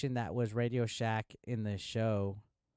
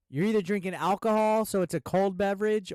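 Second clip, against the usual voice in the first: higher and louder heated rant; 8 semitones higher, 6 dB louder than usual. Real, not acted. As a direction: build it up into an exasperated yell. Ranting about paying for ice.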